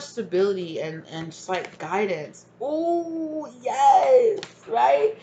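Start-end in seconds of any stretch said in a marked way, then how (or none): 2.6-5.2 excited. Real, not acted. Excited reaction to reading.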